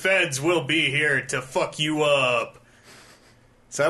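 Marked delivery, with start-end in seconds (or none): 0.0-2.6 loud, slow mock airport announcement, pitched high; booming intercom voice. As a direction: boom it out like a stern terminal announcer. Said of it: "this booming voice comes over the intercom".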